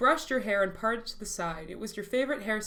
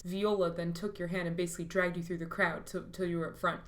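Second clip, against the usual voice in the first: ordinary talk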